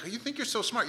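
high-pitched sarcastic jab; mocking. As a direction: taunt them for their conceit. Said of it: "we see this idea in him".

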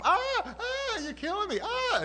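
very high, loud mock shrieks; hammed-up yelps of agony; wailing kid. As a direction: overdo it like a child after one tap. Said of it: "You ever have a kid".

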